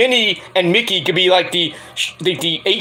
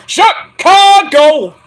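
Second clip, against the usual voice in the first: slow, much higher and louder Chicago; arena hype; 11 semitones higher, 8 dB louder, much slower than usual. Acted. Hyping a chat viewer's city, much higher and louder. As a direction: belt it like a ring announcer.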